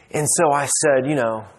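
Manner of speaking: usual speaking voice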